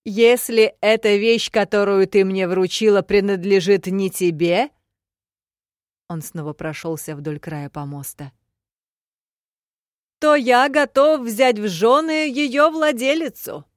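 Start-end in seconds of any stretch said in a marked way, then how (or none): 0.1-4.7 rally speech. A narrator's reading. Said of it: "прокричал толпе". 10.2-13.6 loud, slow address to the crowd; rally speech. A narrator's reading. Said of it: "прокричал толпе".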